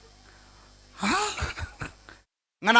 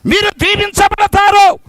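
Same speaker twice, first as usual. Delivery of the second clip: fervent call to come; 8 semitones higher, 6 dB louder than usual. Retold or imitated. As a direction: call the crowd in with fervour. Voices preacher calling people.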